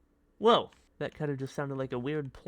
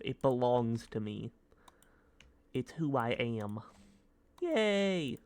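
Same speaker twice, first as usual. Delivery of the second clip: ordinary talk, nothing marked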